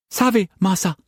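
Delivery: very high, frightened pidgin servant voice; a high timid maid, pleading; frightened servant girl. A narrator's reading. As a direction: servant girl answering her master in fright. Servant Assam obeys.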